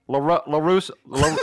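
hesitant, folksy Southern speechmaking; Southern accent. Acted. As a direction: mangle the Spanish in a Southern accent. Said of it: "in your Southern accent".

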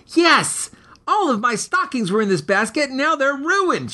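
high, upset mother's voice, last words slowed; hissed back. Acted. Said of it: "she hissed back".

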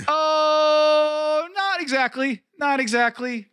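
high, theatrical mock correction; hammy stage actor. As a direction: overplay the denial.

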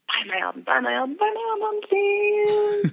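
soft chant climbing to a high plea; playfully beg, pitch rising with each repeat; mock pleading. Musician jokingly begs listeners to buy her album, mock pleading.

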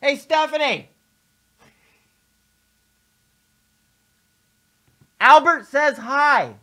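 loud, high call across the room. Real, not acted. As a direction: call out to someone in another room. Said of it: "I'll do it right here and now".

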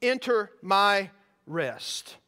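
"enter my rest" very slow, each word set apart; drawn-out, solemn stress on "enter my rest"; emphatic. Acted.

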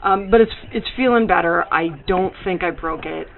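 normal talking voice

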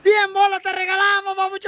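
high shouted radio prize call; excited prize announcement. Real, not acted. Radio host awarding a caller his prize, excited shout. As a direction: shout it out like a game-show win.

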